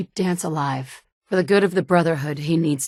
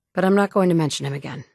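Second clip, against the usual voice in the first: usual speaking voice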